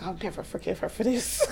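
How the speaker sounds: hushed, with a laugh; soft laughing grudge; quiet mock vow. Friend recapping a show, voicing a quiet laughing grudge.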